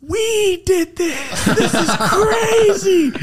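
high, drawn-out squeal of mock amazement; awestruck. Telling how he stood watching the show, awestruck. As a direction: stretch each word out high, thrilled and disbelieving.

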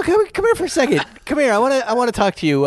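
high and fast, beckoning; calling the washer aside in a hurry; hurried call. Retold or imitated. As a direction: rush him over quickly. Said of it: "I go".

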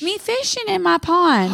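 put-on high, proud little-kid voice; proud toddler announcing his catch. Toddler proudly telling mom he was fishing. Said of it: "And he said".

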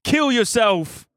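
slow, high-pitched put-on accent; Bevo voice. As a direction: drag out the dark joke in a silly high voice. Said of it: "supposed to be my Bevo voice".

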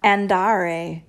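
Andare with a flat American r; American accent on Italian. Shown as a demonstration. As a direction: say Andare with an American r, not rolled. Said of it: "the American accent on an Italian word".

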